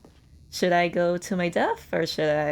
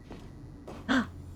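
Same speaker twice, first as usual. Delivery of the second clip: excited gasp; 7 semitones higher, 8 dB quieter than usual. Real, not acted. New subscriber shows up.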